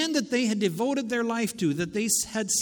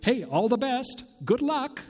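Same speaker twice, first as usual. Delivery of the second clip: breezy, higher-pitched send-off; flippant wish; 5 semitones higher than usual. Acted. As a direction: toss it out as a light good-luck wish. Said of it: "it is not a flippant wish".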